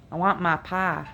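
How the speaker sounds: "my pie" said as "ma pah" with flat Appalachian vowels; Appalachian accent. Shown as a demonstration. Vlogger demonstrating the Appalachian I sound. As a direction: drop the I glide into a flat "ah" in "my" and "pie". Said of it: "the Appalachians say the I sound".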